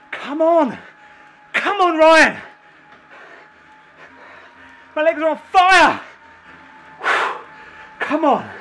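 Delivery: straining, breathless yells mid-effort; cycling hard. Real, not acted. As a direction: push through burning legs, loud and high. Straining near end of FTP test.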